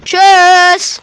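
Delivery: a cheeky shouted farewell; loud high mock goodbye. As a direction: fling the goodbye after her. Waving off the sister.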